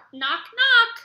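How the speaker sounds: high, loud knocking call; knocking for the children. A narrator's reading. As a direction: make the knock ring out. Knocking at the door.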